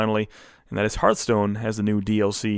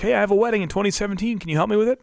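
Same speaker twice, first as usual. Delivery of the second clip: lightly voiced eager client, higher and quick; imitating a client; 8 semitones higher, faster than usual. Retelling people asking wedding help, eager quick imitation. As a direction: chirp the request like a pushy friend. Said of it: "giving me offers and ideas like".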